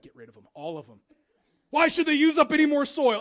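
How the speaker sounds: gruff, dismissive call to rip them out; a hammed-up fed-up gardener barking orders; mock impatient order. Man wanting the daffodils gone, mock impatient. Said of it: "I'm like".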